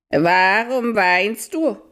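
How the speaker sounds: high, slow, hooting owl. Acted. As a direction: stretch it out like an owl calling in the night. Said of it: "fragte eine Heulstimme". (hohe, langsame Eulenstimme)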